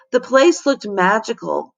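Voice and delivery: plain reading voice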